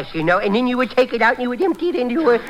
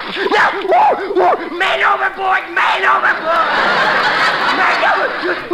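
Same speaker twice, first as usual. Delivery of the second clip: man overboard shout; 7 semitones higher, slower than usual. Acted. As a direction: shout it out, startled and laughing. Drenched with ice water, shouting.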